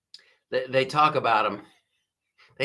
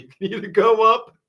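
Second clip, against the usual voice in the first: laughing; 9 semitones higher, 7 dB louder than usual. Real, not acted. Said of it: "I'm gonna ask you guys".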